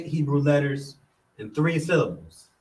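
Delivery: ordinary delivery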